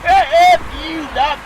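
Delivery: high, loud comic retort; hammed-up hollered brush-off; mock defiant shout. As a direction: yell back at the coach for laughs. Acting out player's reply to coach.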